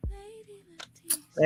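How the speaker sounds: very high and slow, playful squeak; high, slow, teasing question; squeaky high voice. Acted. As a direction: squeak it out in mock doubt. Squeaky check after trying word.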